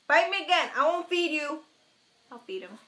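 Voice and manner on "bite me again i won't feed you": louder. Shown as a demonstration.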